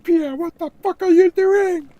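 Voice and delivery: very high, loud hammed-up shriek; screaming Marie. Marie yelling at Pierre after the flames, hammed-up shriek. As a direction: scream it like she's been set on fire.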